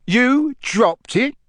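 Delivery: high, slow incredulous question; disbelief. A narrator's reading. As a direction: repeat it in disbelief. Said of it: "repeated Mister Diggory in disbelief".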